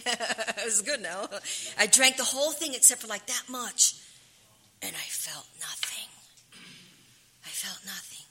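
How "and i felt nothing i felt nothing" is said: breathless whisper, voice gone; real whisper remembering she felt nothing. Real, not acted. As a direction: barely whisper it, hollowed out. Recalling drinking without effect.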